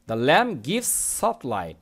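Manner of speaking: each word read out slowly and clearly; slow model reading. Shown as a demonstration. Teacher reading the sentence slowly to students. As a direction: read it slowly for the class to follow. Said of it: "teacher reads model sentence slowly".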